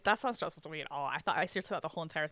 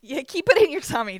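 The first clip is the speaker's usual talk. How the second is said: higher, louder playful quote voice; repeating a friend's silly line, mock voice; quoting Tommy Simbazo; 8 semitones higher, 10 dB louder than usual. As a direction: toss it out as a silly catchphrase. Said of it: "to quote Tommy Simbazo".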